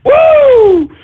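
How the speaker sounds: high, loud laughing whoop; a giddy woo after a strong shot; drunken whoop. Acted. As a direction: let out a wild party whoop.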